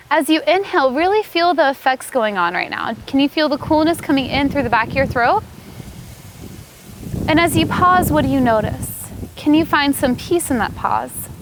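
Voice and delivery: usual speaking voice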